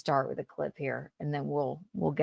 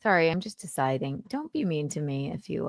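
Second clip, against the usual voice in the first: normal talking voice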